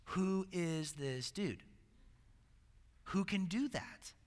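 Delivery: very slow, softened, stunned question; hushed awe. Retold or imitated. Voicing freaked-out people in the boat, in hushed awe. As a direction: let each word land slowly and quietly, as if stunned.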